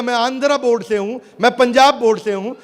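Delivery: building from lively to a high, loud cry; many voices listing boards. Retold or imitated. Speaker acting students naming their boards, rising to a shout. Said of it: "वो बोलेगा".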